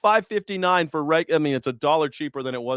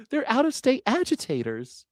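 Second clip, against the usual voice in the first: sarcastic parroting; 9 semitones higher than usual. Pretend.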